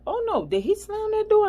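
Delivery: mother hearing the door slam over Bluetooth, alarmed; shocked mom. Retold or imitated. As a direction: gasp it out like a startled mother. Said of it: "And she like".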